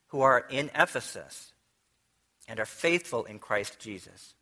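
reading scripture to the congregation, measured and solemn. Acted. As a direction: read the verses out like from the pulpit. Reads Ephesians aloud to congregation.

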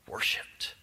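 whispered. Acted. Reading Job's worship verse.

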